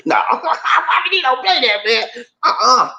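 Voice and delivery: high-pitched laughing protest. Real, not acted. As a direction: protest through a long laugh. Laughing refusal about the heat.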